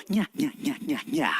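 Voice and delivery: soft, low nya nya cackle; quiet witch cackle. Shown as a demonstration. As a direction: cackle softly like a sneaky witch. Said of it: "Everybody, laugh very quietly".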